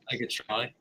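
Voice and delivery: soft Australian accent attempt. Shown as a demonstration. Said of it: "attempting an Australian accent".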